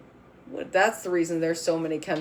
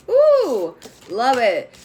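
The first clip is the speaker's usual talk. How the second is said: delighted ooh; 3 semitones higher, 8 dB louder, much slower than usual. Real, not acted. Reader thrilled by the card she drew, loud ooh.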